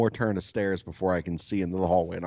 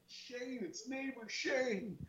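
high, hushed yell with the name drawn out; mock yell; 15 dB quieter, much slower than usual. Neighbor calling over the dogs, mock yelling. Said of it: "So I'm yelling more".

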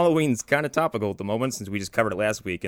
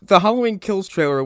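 ordinary talk

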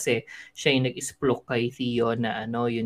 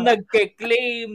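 ordinary talk, nothing marked

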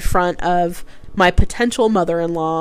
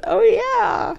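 mother-in-law remembering. Retold or imitated. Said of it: "she listens to this story and she goes".

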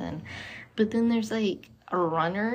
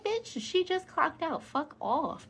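much higher, indignant mock retort; sassy outrage; 9 semitones higher, 4 dB quieter, slower than usual. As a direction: snap back on her behalf.

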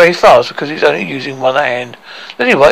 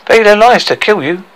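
higher, mildly boastful; proud; 6 semitones higher, 3 dB louder than usual. Acted. Dwarf boasting of those who died trying, proud. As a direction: declare their sacrifice with pride. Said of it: "said Tricky proudly".

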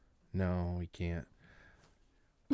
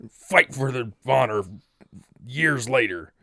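slow dramatic trailer voice; movie trailer voice; 4 dB louder, slower than usual. Pretend. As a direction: intone it like a trailer. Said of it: "Can't just switch like that".

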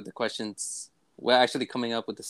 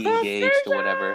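squeaky high voice; 5 dB louder than usual. Pretend. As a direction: jump way up high, mock-sweet. Mock voice after calm remark.

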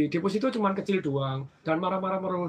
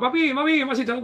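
cheerful greeting; 7 semitones higher, 5 dB louder than usual. Retold or imitated. Greeting the old customers.

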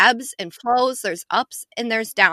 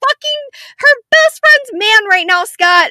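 much louder and higher, shocked; outraged shout; 11 dB louder than usual. Retold or imitated. Wife shouts at husband about the cheating scene, loud. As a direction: shout at the TV in disbelief. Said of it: "I was like".

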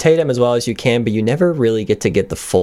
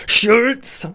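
Colonel Klink's higher-pitched German bark; German accent; 9 semitones higher than usual. Acted. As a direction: call out high in a German accent. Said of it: "that German accent".

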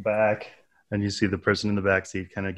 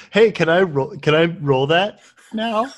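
mock eager; 7 semitones higher, 6 dB louder than usual. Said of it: "[name] fails a roll".

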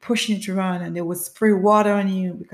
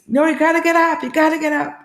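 high, raised urging; urgent command; 9 semitones higher, 5 dB louder, faster than usual. Retold or imitated. Onlookers to an exhausted runner on the ground, urgent command. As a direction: push her back onto her feet.

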